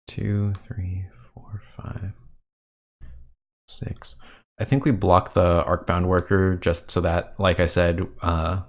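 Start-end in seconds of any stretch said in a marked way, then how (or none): 0.1-4.1 half-whispered, thinking to yourself; muttered counting. Real, not acted. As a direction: count under your breath, very softly. Counting to himself while thinking.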